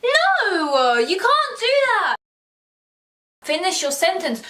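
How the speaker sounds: high, loud "No, you can't do that"; indignant no. Real, not acted. Vlogger scolding friends who stop mid-sentence, loud and indignant. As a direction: scold them loudly, pitch jumping up.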